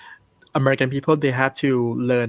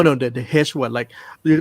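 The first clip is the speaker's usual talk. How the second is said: usual speaking voice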